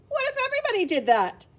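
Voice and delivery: high, announced book title; title read. Shown as a demonstration. Teacher presenting a picture book title to children, grand. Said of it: "I have the pleasure of reading you the story".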